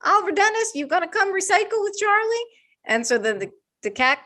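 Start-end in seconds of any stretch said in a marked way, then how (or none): 0.0-2.5 calling the cat to come recycle with the dog, high and coaxing. Retold or imitated. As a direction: call the cat over in a sing-song pet voice.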